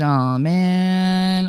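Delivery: drawn-out, mildly whiny groan; mock groan. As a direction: stretch it out like a letdown. Reads out viewer's complaint.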